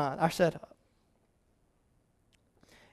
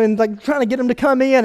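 ordinary talk

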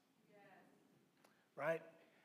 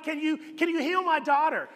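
high, louder desperate plea from a father; pleading father; 7 semitones higher, 5 dB louder than usual. Retold or imitated. Father begging Jesus to heal his daughter, raised desperate plea. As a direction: beg like a father whose child is dying.

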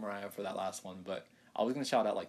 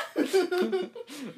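high laugh; 6 dB louder than usual. Real, not acted. Laughing at sister's greeting.

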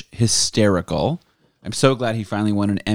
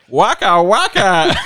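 loud, high comic puppet voice; puppet comic catchphrase; 9 semitones higher, 7 dB louder, much slower than usual. Acted. Comic capping a kosher joke, loud high catchphrase. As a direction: punch it out like a corny puppet comedian.

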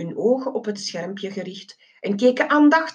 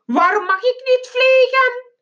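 loud, high-pitched bellow; childish high-pitched yell; roaring; 5 dB louder than usual. A narrator's reading. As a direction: roar the question in outraged protest. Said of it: "terwijl hij brulde".

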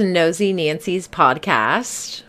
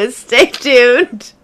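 laughing; 7 semitones higher, 8 dB louder than usual. Real, not acted. Co-host laughing sign-off.

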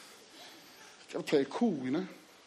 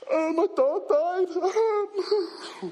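feeble excuse; 11 semitones higher, much slower than usual. Retold or imitated. Crying excuse to friends.

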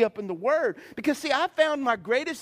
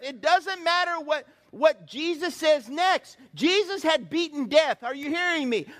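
ordinary talk, nothing marked

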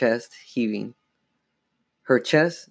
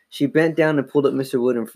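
usual speaking voice

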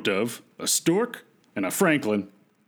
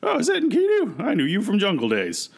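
put-on taunting an old acquaintance in a higher voice; Humbaba; 9 semitones higher than usual. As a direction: start way up high, then come down. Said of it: "And Humbaba says".

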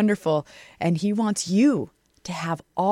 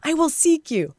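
eager answer; 6 semitones higher than usual. Pretend. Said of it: "our heart enjoins Him and says".

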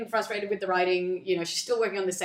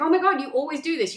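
higher, louder acted outburst; exasperated; 8 semitones higher, 6 dB louder than usual. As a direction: throw the accusation at a partner, fed up. Acted couple's quarrel, her outburst.

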